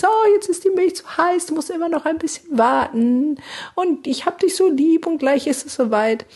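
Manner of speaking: put-on high, sing-song mother cooing to a baby; baby talk. Mother soothing her crying baby, high cooing baby talk. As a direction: coo at the hungry baby like a doting mum. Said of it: "so sprechen wir mit Säuglingen".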